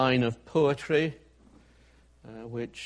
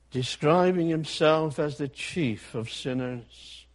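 ordinary talk

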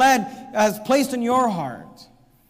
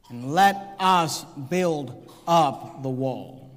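slow solemn call; 7 semitones lower, much slower than usual. Acted. Quoting the call to build.

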